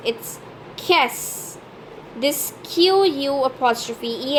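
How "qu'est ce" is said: qu'est-ce said as one syllable, "kess"; pronunciation demo. Shown as a demonstration. Teacher showing learners the phrase, qu'est-ce said as "kess". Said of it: "learn to pronounce this phrase".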